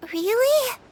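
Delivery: a sudden high, hopeful "Really?"; light up at the news; surprised hope. Acted. Girl asking if guests will share stories, surprised and hopeful.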